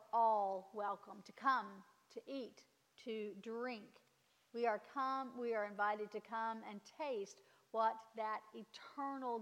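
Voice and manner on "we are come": normal talking voice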